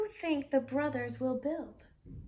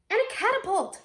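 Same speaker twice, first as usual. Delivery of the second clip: put-on loud, high excited kid; excited shout; 8 semitones higher, 6 dB louder, faster than usual. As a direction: blurt the plan out with glee. Child character excited plan.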